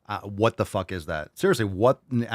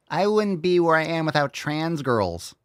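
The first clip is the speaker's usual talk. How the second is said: higher mock-earnest quote voice; 7 semitones higher than usual. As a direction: parody the quote in a raised voice. Mimics the singer's quote mockingly.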